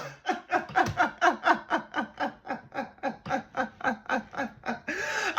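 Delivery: a long helpless laugh at the memory; long real laugh. Real, not acted. Laughing at the client's pushiness, a real laugh. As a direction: let the laugh roll out.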